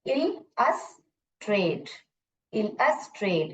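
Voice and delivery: chunked phonics demo; syllable by syllable. Shown as a demonstration. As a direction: break इल, अस, trade into separate beats. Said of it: "इसको scoop करके पढ़ूंगी".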